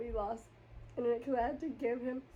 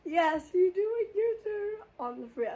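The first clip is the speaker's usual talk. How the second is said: sarcastic yell; 6 semitones higher, 7 dB louder than usual. Retold or imitated. Sarcastic reply to driver.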